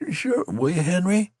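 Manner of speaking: high pleading question; beseeching. A narrator's reading. Dying soldier beseeches Henry. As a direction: beg him to promise. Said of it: "tall soldier beseeched".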